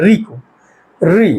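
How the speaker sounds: री said crisply with the tongue curled back; syllable demo. Shown as a demonstration. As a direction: demonstrate री with the tongue tip curled up. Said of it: "देखिए आप उच्चारण करिए". (री को जीभ मोड़कर साफ़ बोलना)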